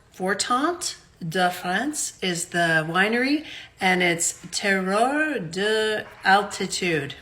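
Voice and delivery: "Fortant de France" and "Terroir de Altitude" said slowly in French; French wine names. Shown as a demonstration. Host reading French wine labels to viewers, slow careful French. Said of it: "I said that terrible".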